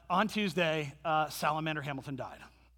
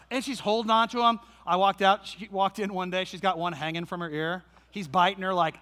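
ordinary delivery

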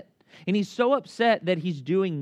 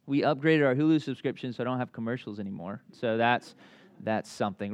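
low, trailing-off mock dull report; underwhelmed; 6 semitones lower than usual. Friend scraping up news for an old pal, underwhelmed. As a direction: let it sink lamely as you go.

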